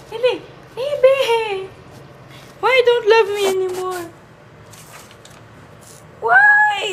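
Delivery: high, loud whiny cry to the cat; mock hurt pleading. Owner pleading loudly with her cat in mock heartbreak. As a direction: beg the pet like a jilted lover.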